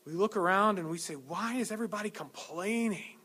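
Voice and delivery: loud, rising sermon delivery; fervent preaching. Acted. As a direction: preach it with building fire. Preaching at full force.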